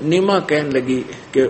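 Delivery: ordinary delivery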